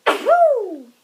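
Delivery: loud whoop after sneeze. Real, not acted. Sneeze reaction.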